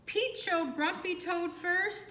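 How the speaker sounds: plain reading voice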